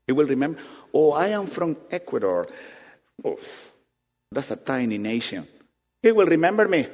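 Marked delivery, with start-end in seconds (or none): none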